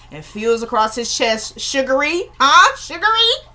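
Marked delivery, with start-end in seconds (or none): 1.6-3.5 high, teasing sing-song taunt; mocking taunt. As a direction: sweetly taunt him, pitched way up and rising. Villain taunting a wounded man.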